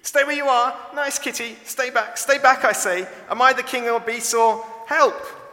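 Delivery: very high, panicky squeak; squeaky. A narrator's reading. Toto backing away from the growling leopard, squeaky. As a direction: squeal it loudly as your bravado collapses. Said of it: "Toto's voice suddenly became squeaky".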